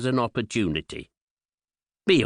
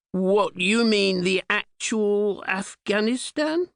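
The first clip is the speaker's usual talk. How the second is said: slow, much higher, dawning horror on Afghanistan; stunned disbelief; 8 semitones higher, slower than usual. Retold or imitated. Checking with his agent, slow and high disbelief. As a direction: ask it as if hoping you misheard. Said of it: "pausing a moment to digest this tiny detail".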